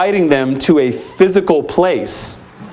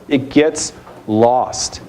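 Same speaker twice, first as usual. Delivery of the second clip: slow heavy emphasis; 2 semitones lower, much slower than usual. Real, not acted. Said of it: "slow emphasis".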